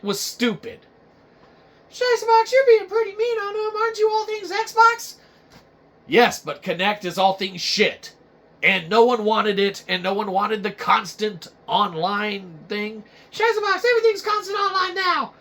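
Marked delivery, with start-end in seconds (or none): 1.9-5.1 high, whiny fan voice; a know-it-all viewer correcting the host; nitpicking viewer. Acted. Viewer correcting Shiza Box in a high whiny voice. 13.3-15.3 high, whiny fan voice; a know-it-all viewer correcting the host; nitpicking viewer. Acted. Viewer correcting Shiza Box in a high whiny voice.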